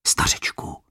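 barely breathed, unvoiced; faint whisper. Adam whispering to the gamekeeper in the night. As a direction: whisper it to the sleeping old man. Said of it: "hlesl Adam".

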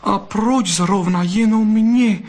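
very high, slow vixen voice; incredulous, overcome. A narrator's reading. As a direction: ask in moved disbelief. Overwhelmed vixen questions fox. (dojatý údiv vysokým hlasem)

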